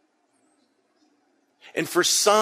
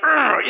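frustrated growl; 8 semitones higher, 4 dB louder than usual. Shown as a demonstration. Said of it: "You know, like".

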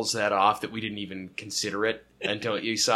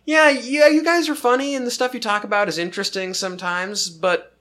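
lightly voiced partygoer; 9 semitones higher, 4 dB louder than usual. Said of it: "has been like".

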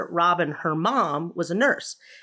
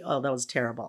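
ordinary talk

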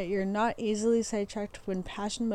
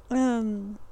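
ordinary delivery

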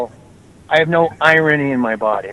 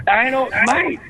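mock catchphrase; 7 semitones higher, 5 dB quieter, slower than usual. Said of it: "Who said dynamite?".

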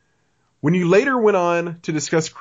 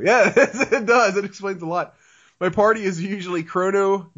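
normal talking voice

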